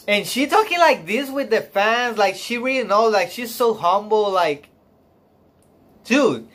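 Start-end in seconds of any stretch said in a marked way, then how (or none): none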